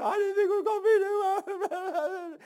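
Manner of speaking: high-pitched, drawn-out crybaby whine; mock whining. Preacher mocking quitters who can't take it, high whining. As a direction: whimper it out slowly in a high mocking voice. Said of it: "and whine and cry".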